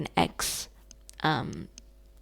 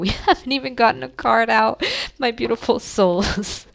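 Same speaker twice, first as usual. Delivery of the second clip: laughing; 5 semitones higher, 6 dB louder than usual. Real, not acted. Laughs at herself, no card yet.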